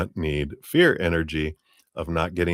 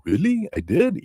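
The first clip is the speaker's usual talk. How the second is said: put-on child's surprise; 12 semitones higher than usual. Inner child surprised by praise.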